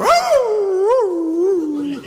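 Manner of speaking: a high mock dog howl; imitated dog howl, high. The dog howling ten minutes later, a high mock howl. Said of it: "el perro".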